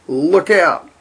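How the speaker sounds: loud, high warning shout. A narrator's reading. Billy warns the frogs of the Viking attack, shouting. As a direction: yell it to warn the frogs. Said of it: "Billy shouted".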